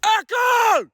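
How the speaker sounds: very high, loud shout; screamed echo call. Real, not acted. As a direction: yell out to make it echo. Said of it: "whatever the fuck I was screaming".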